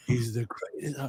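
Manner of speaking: whispered answer. Retold or imitated. Student whispers his answer to the sheikh. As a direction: breathe the answer, barely voiced.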